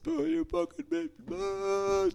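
high-pitched mock screech; hammed-up screaming and hollering; mock screaming caller. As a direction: imitate a caller losing it, way up high. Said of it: "screaming and hollering".

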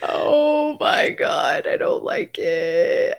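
very slow, drawn-out groaning words; drawn-out dismay. Real, not acted. Reacting to co-host's American accent, drawn-out dismay. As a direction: stretch every word out in whiny dismay.

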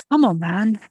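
loud strained gasp while hauling. A narrator's reading. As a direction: push it out through effort. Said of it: "Wes gasped".

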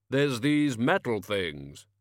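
very slow, booming, grave voice; Death's voice. Acted. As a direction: drag each word out like a tomb.

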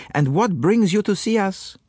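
fussy little Belgian asking his friend; French-accented Poirot. A narrator's reading. Poirot greets Japp.

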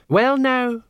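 high-pitched, hurried intervention; quick, high-voiced interruption; hasty interjection. A narrator's reading. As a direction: jump in high to head off a fight. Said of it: "said Sergeant Colon quickly".

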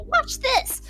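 high character voice; lightly voiced game line; mock Killjoy line. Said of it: "they go".

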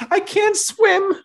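squeaky high mock panic, slowed. To his mom, a mock-panicked high squeak. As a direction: squeal it out like a scared kid.

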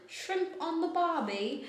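high-pitched mock Australian; Aussie accent. Co-host joining the Aussie bit, mock Australian accent. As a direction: pipe up in a jokey Aussie accent. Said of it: "Australian listeners that we might have al".

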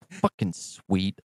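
slow, very soft aside; absorbed self-congratulation. Acted. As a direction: murmur it to yourself, slowly. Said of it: "you hear one of us go".

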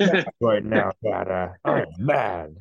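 imitated child working up to mad; mock toddler anger; Wes. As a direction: play a kid announcing his anger to dad. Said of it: "he's, like, dialing up".

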